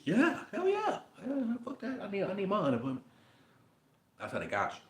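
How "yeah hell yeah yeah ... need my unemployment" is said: high, eager mock yes; mock eager citizen. Mocking a citizen answering the form, high and eager. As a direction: play the gung-ho citizen who blurts yes.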